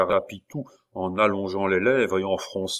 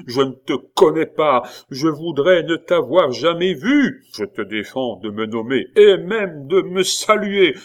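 louder, higher, driving him away; furious rejection; 6 semitones higher, 7 dB louder than usual. A narrator's reading. Abbé disowning the pupil in fury. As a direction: spit out the rejection.

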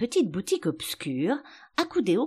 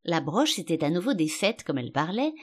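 ordinary delivery

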